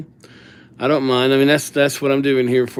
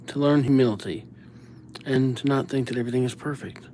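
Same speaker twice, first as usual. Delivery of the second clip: ordinary talk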